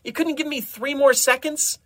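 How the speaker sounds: high-pitched, loud protest; indignant complaint. Acted. Desmond complaining to Doctor Rex about the timing, indignant. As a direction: whine in exasperated disbelief. Frustrated at being cut short.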